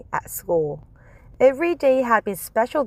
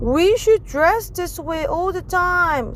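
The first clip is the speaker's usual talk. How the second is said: put-on higher, slow eager girl; girl's voice; 9 semitones higher than usual. Judy Jasper suggesting to the teacher, higher and slow.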